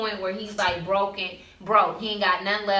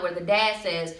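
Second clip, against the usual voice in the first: usual speaking voice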